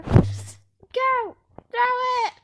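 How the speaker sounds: loud urging shout. Real, not acted. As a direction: yell it at the screen. Urging in game.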